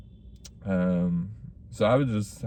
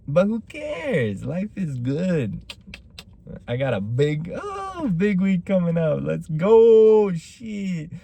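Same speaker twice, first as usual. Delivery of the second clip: hyped up; 8 semitones higher, slower than usual. Real, not acted. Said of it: "hyping himself up".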